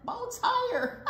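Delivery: high-pitched eager squeal of words; excited cry. A narrator's reading. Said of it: "cried Skunk".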